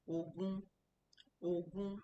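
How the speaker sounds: "ogun" held flat on one level pitch, both syllables even. Shown as a demonstration. Teacher demonstrates Yoruba "ogun" for twenty on a level tone. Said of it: "both on the horizontal".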